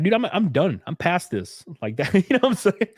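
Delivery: amused, dismissive laugh. Real, not acted. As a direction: laugh as you wave it away.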